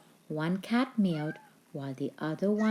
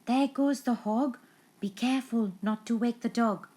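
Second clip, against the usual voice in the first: a thief; 7 semitones higher, 4 dB louder than usual. A narrator's reading. Thief speaking in story.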